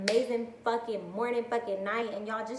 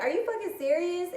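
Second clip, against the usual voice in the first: shocked disbelief; 9 semitones higher than usual. Retold or imitated. Asking her coworker if she's serious, high and shocked. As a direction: pitch it way up in disbelief.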